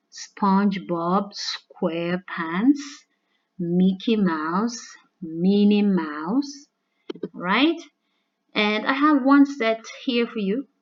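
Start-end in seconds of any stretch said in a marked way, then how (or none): 0.1-6.7 slow listing. Shown as a demonstration. Listing alliterative names.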